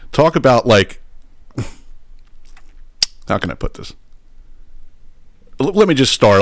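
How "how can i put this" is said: breathy laugh. Real, not acted. Hesitating, laughing over touchy topic.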